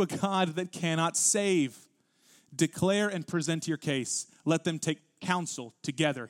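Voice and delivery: solemn, weighty read of God's own words; scripture reading. Acted. Pastor reading Isaiah aloud to the congregation, solemn and weighty.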